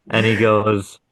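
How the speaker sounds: normal talking voice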